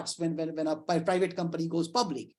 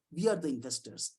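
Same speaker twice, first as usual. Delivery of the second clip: softer, lower, settled conclusion; soft restatement; 4 semitones lower, 7 dB quieter than usual. Shown as a demonstration. Lecturer quietly restating to colleagues that they are the investors. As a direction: say it again quietly to let it land.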